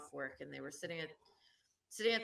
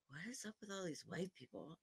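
hushed aside; 11 dB quieter than usual. Retold or imitated. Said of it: "she's like".

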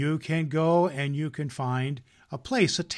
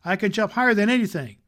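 fast, higher, louder bragging; eager boast; 7 semitones higher, 6 dB louder, faster than usual. Acted. Little rabbit boasting to the wild rabbits, fast and eager. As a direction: rattle it off like a proud little toy.

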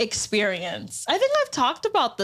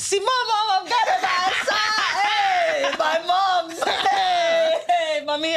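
laughing; 6 semitones higher than usual. Real, not acted. Laughing apology to her mom.

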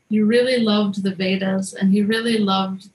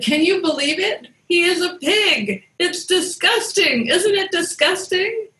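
much higher, appalled and squealing with disgust; disgusted Shiva; 9 semitones higher than usual. A narrator's reading. Shiva to Brahma, pointing at Varaha, disgusted and high. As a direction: recoil at the pig, voice shooting up.

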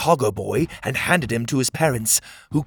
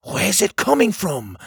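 raised, mildly growled question; higher-pitched lizard voice; growling lizard; 7 semitones higher than usual. A narrator's reading. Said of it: "a lizard growled".

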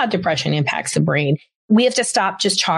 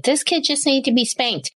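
higher-pitched imitation of a fed-up husband; retold exasperated husband; 8 semitones higher than usual. Quotes friend's frustrated husband.

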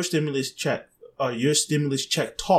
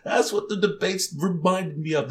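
normal talking voice

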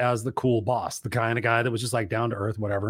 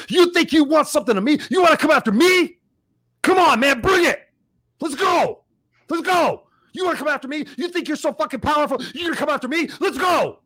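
high, loud reenacted challenge, pushed hard; intense confrontation; 13 semitones higher, 6 dB louder than usual. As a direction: shout it like you're daring him to fight. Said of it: "I went intense on the guy".

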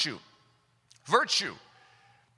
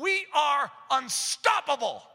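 rally speech; 4 semitones higher, much slower than usual. Acted. Rallying the congregation.

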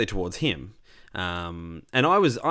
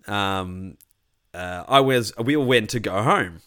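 normal talking voice

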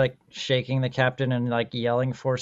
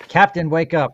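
louder, higher call to rouse someone; urgent plea; 4 semitones higher, 7 dB louder than usual. Acted. Pleads with the lifeless captain, acted.

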